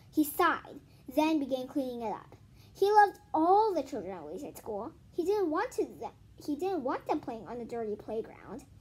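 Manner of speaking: animated read-aloud voice; story narration. Acted. Reads chapter aloud to viewers.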